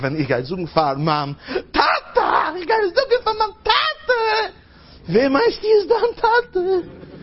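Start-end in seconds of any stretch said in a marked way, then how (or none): 1.7-4.5 put-on squeaky, very high kid wailing for Daddy; child's voice. Child running to tell Tate, squealing child's voice. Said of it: "wie ein Kind sagt". 5.1-7.1 put-on high kid voice, slowing right down at the end; child's voice. Child talking up his Tata, high drawn-out squeal.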